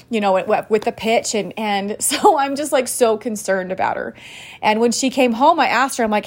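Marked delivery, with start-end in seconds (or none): none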